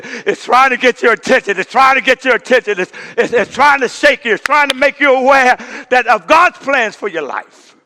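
preacher building to a shout; rising preaching. Acted. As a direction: press the point with fervor.

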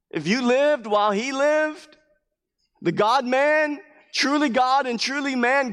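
loud, high, driving sermon delivery; full-force preaching. Acted. Pastor preaching to his congregation, fervent and loud. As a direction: preach it with rising fire.

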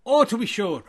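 mock Irish on request; Irish accent. Irish me asked to sound Irish, hammed-up Irish accent. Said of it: "please do Irish".

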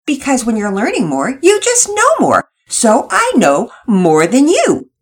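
high, slow, superior sing-song; Penelope gloating over Nora; smug know-it-all. A narrator's reading. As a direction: lord it over her friend. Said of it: "Penelope's attitude was quite insensitive".

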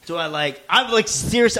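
usual speaking voice

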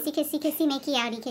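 soft little make-out babble; kissy murmur. Acted. Improviser voicing a make-out, soft kissy murmur. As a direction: murmur it in mock smooching.

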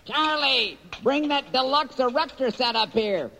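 high villain voice. Acted. Villain Slocum character voice.

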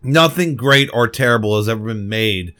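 usual speaking voice

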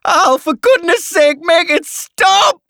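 high, loud desperate scream; frantic shriek, drawn out on the final plea; Carly screaming. A narrator's reading. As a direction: scream for it to end. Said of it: "screams Carly".

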